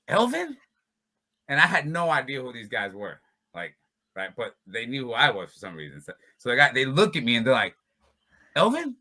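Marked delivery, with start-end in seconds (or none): 0.1-0.6 surprised. Retold or imitated. Retold guys recognising him. 8.5-8.9 surprised. Retold or imitated. Retold guys recognising him.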